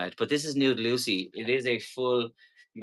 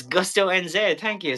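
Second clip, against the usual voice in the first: high, loud excited shoutout; excited thanks; 7 semitones higher, 5 dB louder than usual. Real, not acted. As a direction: burst out thanking a viewer.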